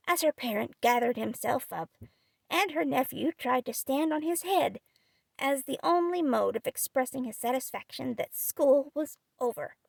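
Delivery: ordinary delivery, nothing marked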